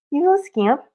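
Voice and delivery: high, teasing coo at the cat; playful high pet voice; pet talk. Real, not acted. Cooing over the cat.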